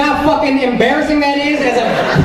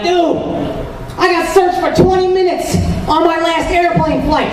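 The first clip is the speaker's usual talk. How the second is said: higher-pitched, excited buddy telling his story; animated friend voice; 6 semitones higher than usual. Acted. Friend telling his buddies about being searched, excited. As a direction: act out an excited friend's airport tale.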